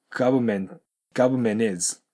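"government" with the T dropped, like "gabmen". Shown as a demonstration. Teacher showing students the silent T. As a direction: swallow the T in "government". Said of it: "Tの音が消えてます".

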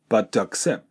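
"but to" merged, single T into accept; linked but to. Shown as a demonstration. Teacher isolates the linked phrase. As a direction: run "but to accept" together. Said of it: "これがくっついて".

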